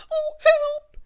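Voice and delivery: Mister Jelly panicking at the rain, shrieking. Acted. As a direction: shriek in sudden fear. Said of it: "shrieked Mister Jelly".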